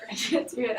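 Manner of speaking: laughing through the words. Real, not acted. Laughing to the class about her bad Spanish. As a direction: giggle out the admission.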